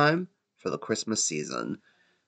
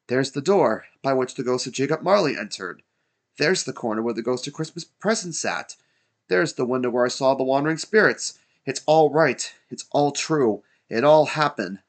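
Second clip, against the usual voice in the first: higher exclamations, growing louder; excited crying out; 5 semitones higher, 4 dB louder than usual. A narrator's reading. Scrooge spotting proof of the spirits' visit, excited cries. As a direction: build to triumphant certainty. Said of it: "cried Scrooge".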